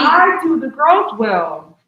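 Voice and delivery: loud and fierce, the second part slow; emphatic. Acted. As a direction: declare it loudly, no room for doubt. Insisting during emotional plea.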